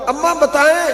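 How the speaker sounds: put-on high, insistent child's plea; child begs his mother to tell him, high and pleading. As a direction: beg your mother like a small child. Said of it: "بچے پوچھے".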